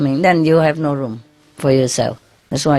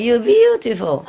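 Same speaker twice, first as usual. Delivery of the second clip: mock parrot; 7 semitones higher than usual. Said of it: "They told me".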